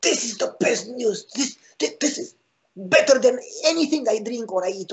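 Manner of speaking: high, amazed retelling; imitating a thrilled new believer; excited convert. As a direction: gush like it's the best news ever. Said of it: "He was like".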